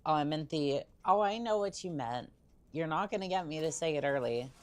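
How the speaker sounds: ordinary delivery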